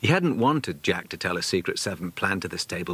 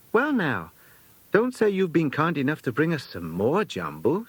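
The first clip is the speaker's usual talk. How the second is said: lightly voiced fussy lady companion, higher; a hint of a bustling old companion at the door; chatty companion; 8 semitones higher than usual.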